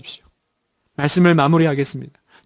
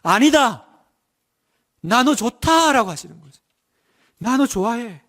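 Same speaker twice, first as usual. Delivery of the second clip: rising higher, bright with delight; a lightly voiced joyful God; joyful divine voice; 7 semitones higher than usual. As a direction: sing out God's fond joy. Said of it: "하나님 말씀하시는 거예요".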